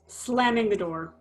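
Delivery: normal talking voice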